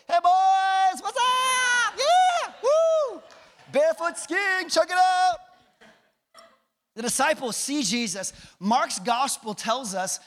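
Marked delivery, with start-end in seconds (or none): 0.1-5.4 high, loud, hammed-up holler; whooping greeting. Jesus hailing the disciples from across the water, whooping playfully. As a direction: call out like a show-off barefoot skier.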